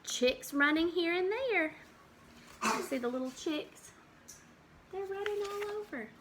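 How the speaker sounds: put-on lively storybook read for little kids; reading to children. Teacher reads a farm book to the class, lively storybook voice. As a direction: read it out like a picture book at circle time.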